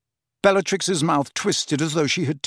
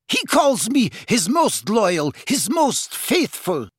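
very high, fervent boasting; heated, zealous high voice; fired up; 10 semitones higher than usual. A narrator's reading. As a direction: insist proudly, pitch soaring. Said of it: "firing up at once".

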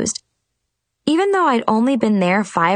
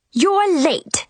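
screaming; 10 semitones higher than usual. A narrator's reading. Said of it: "she screamed".

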